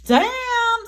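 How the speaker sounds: high, loud startled exclamation; sudden high damn; surprised damn. Real, not acted. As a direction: blurt it out in surprise. Reacting to card drawn.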